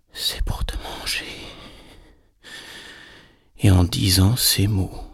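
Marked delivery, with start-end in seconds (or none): 0.1-1.2 soft, sinister whisper; menacing whispering wolf. A narrator's reading. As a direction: whisper the threat to the child. Wolf reveals his intent.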